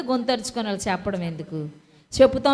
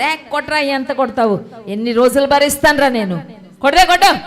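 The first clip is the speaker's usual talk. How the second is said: put-on defiant child; 3 semitones higher, 7 dB louder than usual. Defiant child voiced in story.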